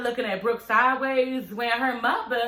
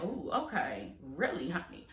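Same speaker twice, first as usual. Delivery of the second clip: hushed aside; 7 semitones lower, 11 dB quieter than usual. Retold or imitated. Retelling her under-breath reaction.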